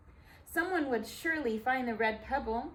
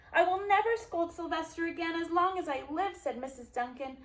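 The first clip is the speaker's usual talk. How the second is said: higher, tearful vow; grieving mother; 7 semitones higher than usual. Acted. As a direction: promise it through heartbreak. Grieving mother vows tenderness.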